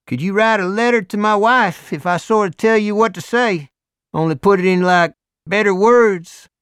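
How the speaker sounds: big Southern biker voice; Deep South accent. A narrator's reading. Hell's Angel asking Morris, loud Deep South accent. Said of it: "Deep South accent".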